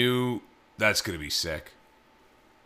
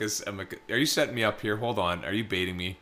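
wary aside; 6 semitones lower, 8 dB quieter than usual. Pretend. Imagined wary reply to the question.

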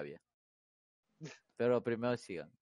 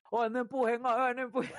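higher mock old woman pleading; old lady's complaint; 8 semitones higher than usual. Teller mimics the old lady on the bus, higher and pleading. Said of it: "la vieja hizo así".

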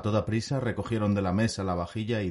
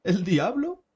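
much higher, amused question; mocking bard; 12 semitones higher, 3 dB louder, slower than usual. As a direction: tease the old man. Bard mocks the idea. (bardo burlón, voz mucho más aguda)

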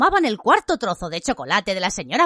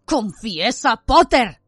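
angry demand, louder on the name; accusing shout in a put-on voice; vociferating; 4 dB louder, much slower than usual. A narrator's reading. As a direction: order a confession, building to a shout. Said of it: "vociferó".

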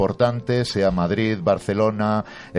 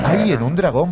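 excited; 10 semitones higher, 6 dB louder than usual. Real, not acted. Delight at the child's answer.

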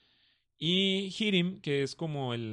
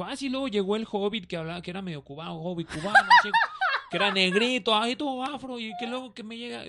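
higher, sing-song parody of a storyteller; mock narration; 6 semitones higher, 4 dB louder than usual. Debunking what Tolkien supposedly wrote, playful mock narration. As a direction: ham up an invented character description.

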